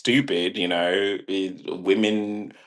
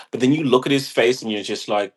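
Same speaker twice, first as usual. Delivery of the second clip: ordinary talk, nothing marked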